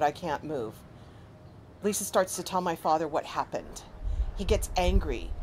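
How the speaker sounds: normal talking voice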